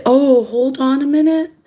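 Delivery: put-on polite phone voice, lifted in pitch; pretend stalling. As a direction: play innocent while stalling a caller. Rehearses stalling the caller next time.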